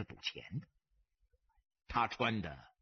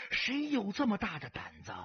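plain reading voice

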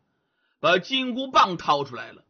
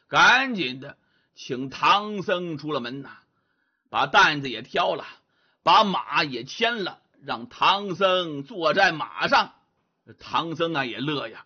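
ordinary delivery, nothing marked

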